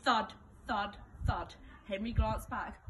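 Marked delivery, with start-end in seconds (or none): none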